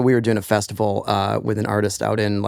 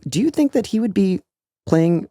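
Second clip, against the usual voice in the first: high-pitched, disbelieving question; retold mock disbelief, pitched high; incredulous reply; 8 semitones higher than usual. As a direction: ask it way up high, as if it is absurd. Retold sceptical reply to insistent stagehand.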